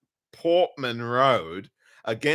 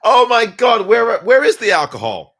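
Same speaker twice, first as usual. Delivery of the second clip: mock exasperated; 8 semitones higher, 11 dB louder than usual. Real, not acted. Exasperated at another blunder.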